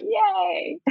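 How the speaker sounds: high, soft little yay; a quick squeal of mock delight; mock cheer. Answering any question with a soft cheer. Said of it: "and be like".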